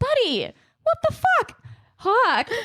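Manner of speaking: high mock-exasperated scold on fuck; exasperated mom. Mom telling her son Hawk off, high mock exasperation. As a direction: playfully scold the toddler in disbelief. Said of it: "And I'm like".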